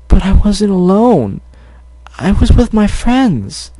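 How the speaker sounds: protesting little sister. Acted. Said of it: "Cherry protested".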